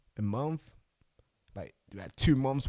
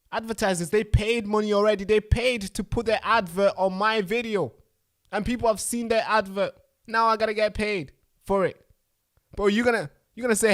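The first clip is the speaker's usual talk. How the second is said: much higher, louder indignant rant; angry rant; 9 semitones higher, 8 dB louder than usual. Real, not acted. Host furious at YouTube keeping his money, loud rant. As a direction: vent the outrage at full tilt. Ranting about withheld money.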